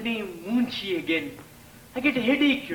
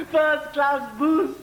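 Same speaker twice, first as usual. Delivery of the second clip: First class booze drawn out slowly; slow savoring; 4 semitones higher, much slower than usual. Acted. To Saras, slowly savoring the booze. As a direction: relish each word of First class booze.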